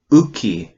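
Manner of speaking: Uki said slowly, clear glottal onset; Kootenai word. Shown as a demonstration. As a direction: say Uki with a catch at the start. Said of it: "the first number is number one".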